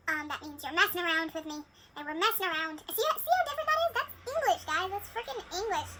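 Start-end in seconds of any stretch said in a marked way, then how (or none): 2.9-5.9 excited disbelief. Real, not acted. Amazed at Yorkshire dialect.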